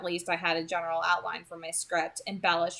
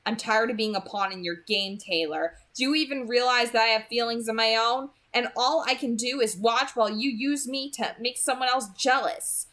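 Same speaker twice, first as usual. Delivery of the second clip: louder, rising indignant complaint; acted anger; 4 semitones higher, 4 dB louder than usual. Beau publicly accusing Taylor, louder hurt anger. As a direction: play the wounded, furious boy. Staged breakup rant in cafeteria.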